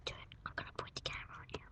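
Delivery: fast secret whisper. Real, not acted. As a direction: whisper it quickly, no voice. Secret aside to friend.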